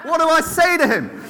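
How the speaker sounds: high, urgent silent-prayer voice acted out for laughs; desperate prayer. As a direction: plead with God in mock desperation. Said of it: "in desperation, I prayed".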